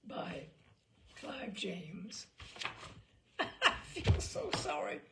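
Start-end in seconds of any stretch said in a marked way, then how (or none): 2.3-3.0 a short soft chuckle; soft laugh. Real, not acted. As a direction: breathe out a little laugh. Reader laughs after finishing story.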